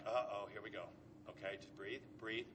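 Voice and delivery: calm yourself down under your breath before the blow lands; a child bracing quietly for his father; nervous self-talk. Retold or imitated. Bracing himself for dad's call.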